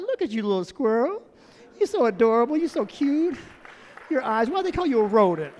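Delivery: put-on sing-song baby talk, swooping upward; cooing at an animal. Lady admiring a little squirrel, cooing. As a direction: coo with questions lifting at the ends.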